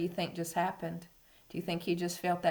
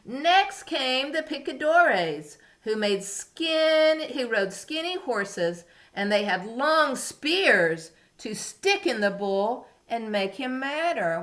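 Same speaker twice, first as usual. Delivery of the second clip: slower, higher storybook narration; reading to a toddler; 6 semitones higher, 6 dB louder, slower than usual. A narrator's reading. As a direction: build it up for the little ones. Reading story to young children.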